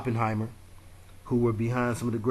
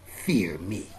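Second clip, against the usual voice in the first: low, growled demon voice; horror movie villain voice; 4 semitones lower than usual. Retold or imitated. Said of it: "classic caricature of a horror movie villain voice".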